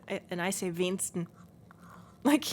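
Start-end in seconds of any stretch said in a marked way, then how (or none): 0.7-1.3 wenigsten shortened to "weensten", middle syllable gone; northern Platt pronunciation. Shown as a demonstration. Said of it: "I'm from the north".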